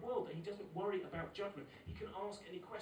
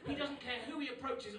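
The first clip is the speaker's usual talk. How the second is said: ordinary delivery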